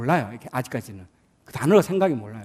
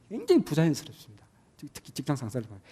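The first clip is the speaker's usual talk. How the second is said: normal talking voice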